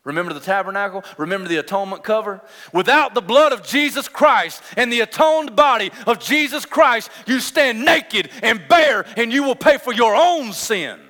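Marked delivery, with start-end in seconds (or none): 2.7-11.0 emphatic, measured pulpit cries; full-force preaching. Real, not acted. Preacher warning sinners they stand uncovered, with emphatic force. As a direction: hammer each phrase as a solemn warning.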